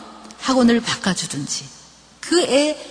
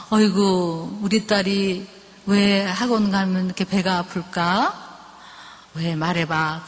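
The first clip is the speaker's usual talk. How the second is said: lower, softer, tender coaxing; soft motherly voice, lower and hushed; gentle coaxing mother; 5 semitones lower, 3 dB quieter than usual. Shown as a demonstration. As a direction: coax a sick child to open up. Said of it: "한번 이렇게 하는 거야".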